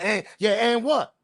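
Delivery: high, snappy "and what?" thrown back; mock dismissive caller. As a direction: brush off the dispatcher like you're busy. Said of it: "You always gotta hear the tone of the voice".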